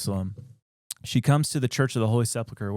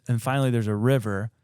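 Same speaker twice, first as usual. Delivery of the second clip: ordinary delivery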